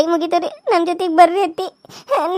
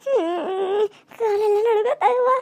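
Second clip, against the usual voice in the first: quiet weeping; 3 semitones higher, 8 dB quieter, much slower than usual. Acted. Grieving woman after a death.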